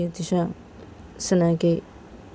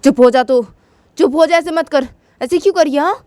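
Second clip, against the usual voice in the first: louder, rising high angry scolding; slap-and-scold, getting higher; angry brother; 10 semitones higher, 5 dB louder than usual. Retold or imitated. Brother scolding Tisha after slapping her, angry. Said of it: "बोलते".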